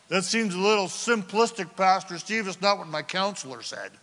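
lightly mocking skeptic voice. Congregant doubting the pastor, mild mocking tone. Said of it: "mocking tone that I just gave you".

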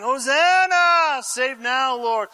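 drawn-out high, loud cheer, easing off after; acted shout of acclamation; crowd shouting. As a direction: cry it out like one of a jubilant crowd. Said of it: "and they're shouting".

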